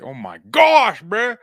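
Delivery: loud, high "oh my gosh"; exasperated outburst. Real, not acted. Vlogger losing it over his slurred speech, loud exasperation. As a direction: throw up your hands in frustration.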